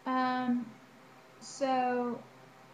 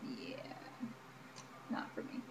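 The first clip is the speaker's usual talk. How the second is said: whispered aside; 10 semitones lower, 14 dB quieter than usual. Real, not acted. Muttered aside after pause.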